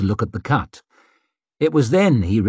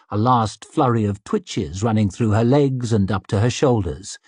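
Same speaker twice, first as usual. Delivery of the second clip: usual speaking voice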